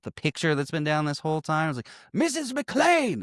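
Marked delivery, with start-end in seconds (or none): 2.1-3.2 high, drawn-out mock exclamation; villain's realization. Retelling the villain spotting his hostage, mock exclamation. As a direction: stretch the name out high, as an imitated villain.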